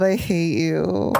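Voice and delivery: ordinary talk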